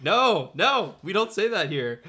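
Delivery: high, loud comic protest; mock objection. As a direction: correct him in playful outrage. Mock-correcting the actor's Britishism.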